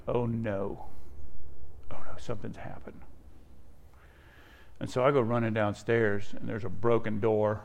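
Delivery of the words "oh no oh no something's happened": worried whisper. Retold or imitated. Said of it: "And I'm like".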